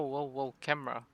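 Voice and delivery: ordinary talk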